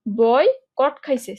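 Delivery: Boycott খাইছে stretched out very slowly; slow mnemonic. Shown as a demonstration. Teacher slowly giving a spelling trick. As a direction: drag boycott খাইছে so it sticks. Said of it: "mnemonic drawn out".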